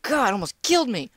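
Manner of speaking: ordinary delivery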